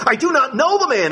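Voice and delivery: high, insistent denial; Peter denying. Acted. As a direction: swear you never knew him, high. Peter curses, denies.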